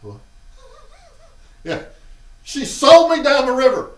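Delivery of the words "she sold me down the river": loud, high indignant outburst; outraged cry. Retold or imitated. Brother betrayed by his sister, loud and indignant. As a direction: yell it like you've been betrayed.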